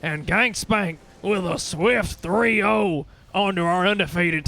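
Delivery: live esports casting, rising with the action; play-by-play. Acted. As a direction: call the fight as it happens. Casting the final fight live.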